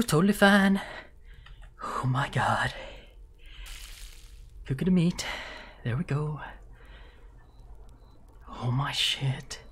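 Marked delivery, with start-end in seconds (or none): 1.8-2.7 whispering. Real, not acted. Scared murmur. 8.5-9.6 whispering. Real, not acted. Scared murmur.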